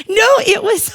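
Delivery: laughing burst, high and loud. Real, not acted. Laugh heard.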